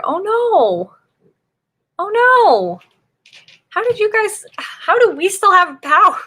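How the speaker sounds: high, loud dismayed oh no. Real, not acted. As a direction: gasp it out in real dismay, pitched high. Hears a friend's uncle lost power.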